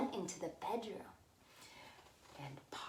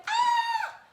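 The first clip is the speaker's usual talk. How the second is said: high loud shriek of fright; scream; 10 dB louder than usual. Acted. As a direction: shriek as she sees the bears. Goldilocks screams at bears.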